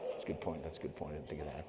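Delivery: low soft crestfallen mumble; deflated. Retold or imitated. Climber conceding the sponsor's point, deflated and quiet.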